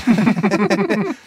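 high, rippling relieved laughter; giggling. Acted. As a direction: burst into light high giggles. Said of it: "Jule und Leo lachen". (helles Kichern)

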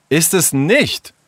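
high, drawn-out angry retort. Acted. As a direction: call out in angry contradiction. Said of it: "rief Leo wütend". (wütender Widerspruch)

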